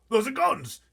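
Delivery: high, loud frightened cry; a blustering general in a panic; terrified army chief. Acted. Army head crying out from the back seat, terrified. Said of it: "he cried".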